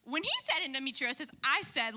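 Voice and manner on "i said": ordinary delivery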